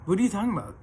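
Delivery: mildly heated retold protest; imitated indignant denial. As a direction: imitate an ex insisting on innocence. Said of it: "like, the crazy eyes again".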